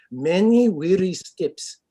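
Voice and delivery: slow, hammed-up Russian accent; bad Russian accent. Shown as a demonstration. Actor showing off his bad accents on request, hammed-up Russian. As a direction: draw it out in a loud, fake Russian accent. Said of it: "bad Russian accent".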